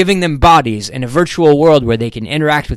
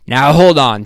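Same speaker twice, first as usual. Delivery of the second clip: loud, higher interruption; irritated; 4 semitones higher, 8 dB louder than usual. A narrator's reading. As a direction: cut in with mild irritation.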